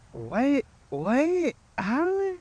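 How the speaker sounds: high, rising squeaks of shock; imitating a stunned what-what-how; shocked disbelief. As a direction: act floored, voice jumping way up. Shocked reaction to her news.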